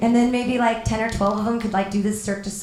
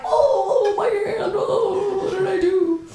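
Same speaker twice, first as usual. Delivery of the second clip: high-pitched, hammed-up wail; mock agony; 8 semitones higher, slower than usual. Babysitter playing along with frozen hand, mock agony. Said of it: "And I'm like".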